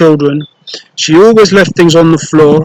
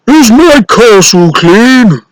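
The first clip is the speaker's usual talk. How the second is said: slow, loud, higher giant call; giant's yell; 6 semitones higher, 4 dB louder, slower than usual. Acted. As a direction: demand it across the castle. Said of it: "the giant would yell".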